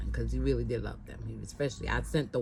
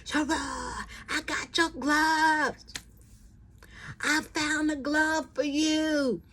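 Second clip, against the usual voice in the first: high, slow sing-song holler; drawn-out high window call; 4 dB louder, much slower than usual. Retold or imitated. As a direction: call down to her on the street below. Man calling from window.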